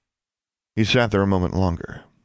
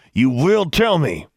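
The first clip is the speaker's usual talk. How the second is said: slow, louder, higher-pitched demand; angry command; 8 semitones higher, 5 dB louder, slower than usual. A narrator's reading. Brother forcing an answer from defiant sister, angry command. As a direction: order it slowly with rising force.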